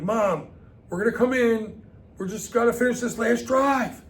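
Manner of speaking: put-on high, loud protesting kid shout; kid yelling back. Kid begging mom to finish game, loud protest.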